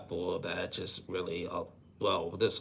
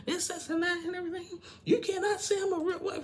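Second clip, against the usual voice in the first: mimicked angry counterattack; loud, very high accusing rant; Rebecca; 5 dB louder than usual. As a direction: act out a wife turning it back on her husband. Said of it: "Rebecca turns it back on him".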